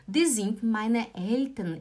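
ordinary talk

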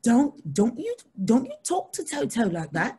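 mock Scottish accent, indignant; amused attempt at a Scottish telling-off. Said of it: "Caroline was from Scotland".

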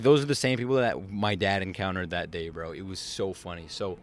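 ordinary delivery

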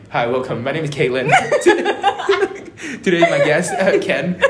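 chirpy host welcome, laughing; mock podcast opener; podcast host intro. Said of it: "And you're like".

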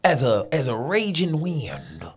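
slow dramatic eulogy voice; hammed-up heartfelt tribute, slowing down; mock eulogy.

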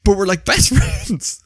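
ordinary delivery

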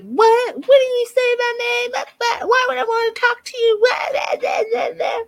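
very high, shrill-voiced mock screaming; hammed-up high mocking rant with fake blah blah; mocking the wife. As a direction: squeal it like a ranting caricature of the wife. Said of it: "And she's like".

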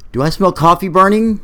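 groggy. Acted. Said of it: "A groggy voice said".

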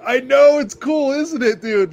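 thrilled by your own injury; gleeful. Retold or imitated. Injured man gleefully telling his friend the broken toe is cool, high and loud.

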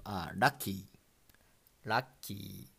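"lucky" as ra-kki, flap r for l; ラッキー; Japanese pronunciation. Shown as a demonstration. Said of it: "we pronounce lucky".

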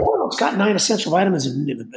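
a hint of a gullible shopper; imitated impressed shopper. Acts the parent grabbing the box.

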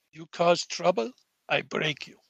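soft menacing threat, lightly Russian; a quiet bouncer threat in a hint of a Russian accent; slight Russian accent. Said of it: "I can do slight Russian accents".

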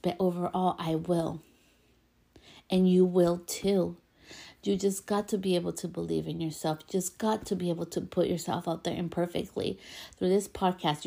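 ordinary talk, nothing marked

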